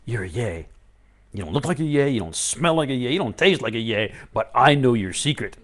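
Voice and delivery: knowing coax. Pretend. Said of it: "you can say".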